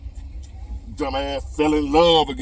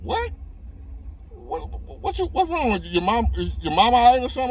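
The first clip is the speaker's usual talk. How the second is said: alarmed; 11 semitones higher than usual. Retold or imitated. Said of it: "I'm like".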